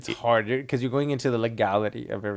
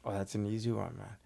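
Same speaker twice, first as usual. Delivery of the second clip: normal talking voice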